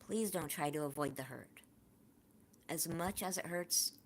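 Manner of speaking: normal talking voice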